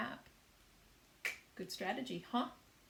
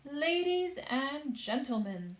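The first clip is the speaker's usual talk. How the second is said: mild forced calm; 6 semitones higher, 5 dB louder than usual. A narrator's reading. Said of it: "trying very hard to keep the fear".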